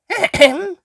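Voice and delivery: high, pointed throat-clear; pointed ahem. A narrator's reading. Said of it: "Susie coughed".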